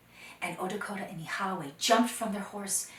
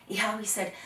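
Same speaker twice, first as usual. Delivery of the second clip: ordinary delivery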